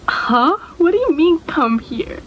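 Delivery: high, confused disbelief; startled, puzzled question; surprised. Retold or imitated. Shocked reply to dad.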